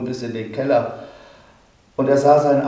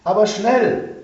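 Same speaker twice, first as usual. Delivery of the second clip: man shouting to his neighbours for help; calling to neighbours; 8 semitones higher, slower than usual. A narrator's reading. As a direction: call the neighbours over urgently. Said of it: "rief zu seinen Nachbarn".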